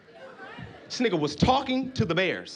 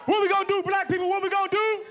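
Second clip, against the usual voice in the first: high-pitched, raised-voice call-out; rallying call; 10 semitones higher than usual. Acted. Comic calls to his people, rallying. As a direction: put the question high, like rallying a crowd.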